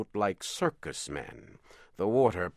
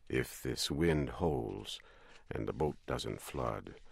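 low calm captain; 8 semitones lower, 7 dB quieter than usual. A narrator's reading. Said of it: "He always spoke in a low voice and calmly".

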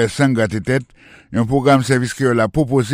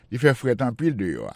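ordinary delivery

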